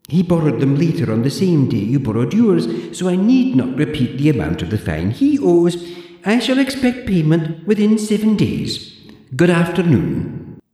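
put-on high, prim old librarian voice; haughty zombie librarian, clipped and proper; librarian Deirdre. Deirdre lectures the borrower in a high prim voice.

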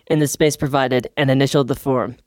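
ordinary talk, nothing marked

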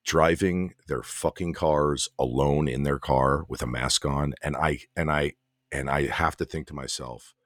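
low, slow, measured disdain; deliberate griping. Real, not acted. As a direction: lay out the pet peeve slowly and low. Slow, deliberate rant about drivers.

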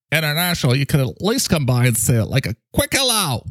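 very high, squeaky put-on character; playhouse character voice. Playhouse character pleading for a visit, high squeaky voice. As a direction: climb into a falsetto squeal by the end.

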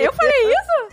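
horrified she ever said it; shocked disbelief. Real, not acted. Repeats question, even higher.

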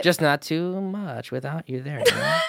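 high teasing put-on voice; rat race voice. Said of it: "Was that your rat race voice".

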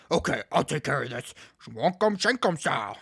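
low, assured leader voice; cool hero voice. Retold or imitated. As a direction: drop low as the hero taking charge.

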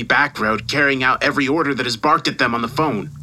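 emphatic scolding with leaping pitch; irate boss dressing someone down; angry tirade. Acted. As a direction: tear into a useless employee. Boss's furious tirade down the phone.

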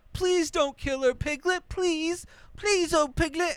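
high, pleading mock whimper in a put-on voice; mock the grown man begging like a little child; whiny childish begging. Mocking Christopher begging Piglet to spare his fiancée, high childish whine. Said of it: "like a little child begging and pleading".

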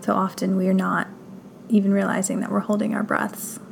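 guided meditation. Acted. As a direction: guide them gently through breath and body, unhurried.

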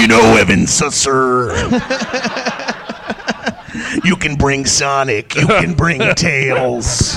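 wrestling promo. Pretend. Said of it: "I'm cutting, like, a wrestling promo right now".

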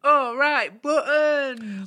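very high, very loud catchphrase; panto call. Acted. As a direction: belt it out to the back row. Repeats panto catchphrase even bigger.

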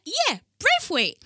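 high, loud, drawn-out "Braithwaite" with long "ay"; mimicked mispronunciation. Mimicking people who ignore her spelling, loud high "Braithwaite". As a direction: parrot "Braithwaite" confidently, stretched and high. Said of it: "and they're like".

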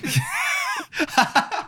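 a burst of high squealing laughter; high shrieking laugh. Real, not acted. [name] cracking up at his own British joke, shrieking laugh.

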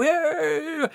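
high, drawn-out mock cheer; retold cheer. Wedding guests cheering arrivals.

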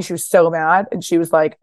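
normal talking voice

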